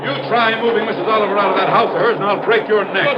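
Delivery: high, furious bellow at a worker; booming angry voice. Acted. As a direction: roar it out like an outraged boss. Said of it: "Mister Haskell's booming voice".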